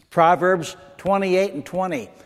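ordinary talk

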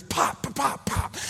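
quick, high mouth-made gunfire; imitated gunshots. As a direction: fire off popping shot noises.

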